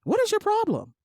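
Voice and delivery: shrill-free high mock question; high exasperated repeat. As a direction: throw the question up high in disbelief. Mocking repeat, much higher.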